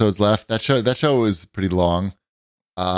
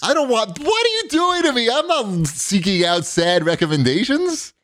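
mock outrage; 13 semitones higher than usual. Friend teases him with sad show.

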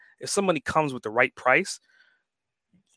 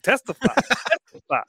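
high laughing testify; church call-out; 8 semitones higher, faster than usual. Retold or imitated. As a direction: shout back like a churchgoer. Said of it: "and I'm just like".

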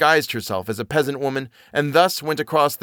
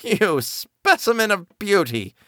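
high, slow sneering witch; wicked witch; 9 semitones higher, slower than usual. Acted. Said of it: "said the wicked woman".

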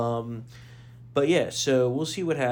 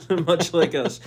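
nervous laugh through the words; laughing off fear; 4 semitones higher, 4 dB louder, slower than usual. Real, not acted. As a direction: laugh it off, slower. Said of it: "I laugh. I'm like, I'm nervous".

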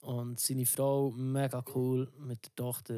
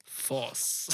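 dog command. Retold or imitated. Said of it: "wie du dem Hund sagst".

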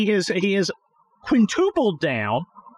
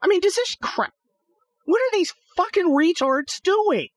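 pitched way up in real exasperation at the drivers; exasperated outburst; 12 semitones higher than usual. Real, not acted. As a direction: blurt it out high, fed up with the trucks. Cursing at slow trucks on road.

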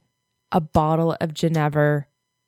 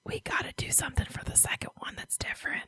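whisper; 8 semitones higher, 13 dB quieter, faster than usual. Real, not acted. Whispered aside to co-host.

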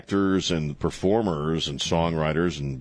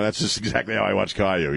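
usual speaking voice

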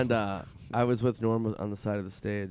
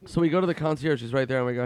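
ordinary talk, nothing marked

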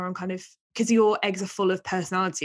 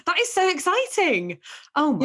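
delighted and much higher; excited; 10 semitones higher than usual. Real, not acted. Gasping at the Paris news.

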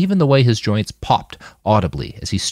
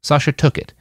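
plain reading voice

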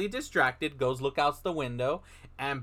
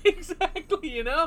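laughing; 12 semitones higher than usual. Real, not acted. Laughing at a joke.

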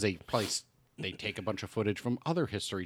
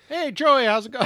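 very high, loud called-out greeting; Da Vinci as vlogger; 10 dB louder than usual. Pretend. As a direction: hail a follower in a hammed-up high voice. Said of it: "It's a Da Vinci here again".

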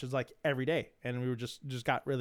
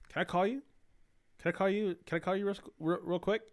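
higher, eager rush of the same question; eager friend; 7 semitones higher than usual. Retold or imitated. Buddy begging to call, higher and eager. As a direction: imitate the buddy pleading to call right away.

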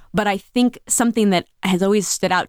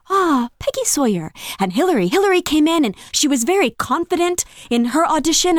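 meek woman voice; 8 semitones higher than usual. Retold or imitated. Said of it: "she's this very meek woman".

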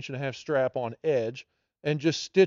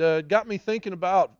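ordinary talk, nothing marked

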